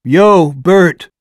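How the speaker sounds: loud casual call to the next room; loud offhand call. A narrator's reading. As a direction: holler a quick greeting. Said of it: "he called".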